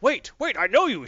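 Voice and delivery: high, loud startled cry; frightened recognition. Retold or imitated. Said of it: "she's like".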